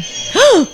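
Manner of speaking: sharp audience gasp; shocked gasp. Real, not acted. Friend reacting to a film scare, shocked gasp.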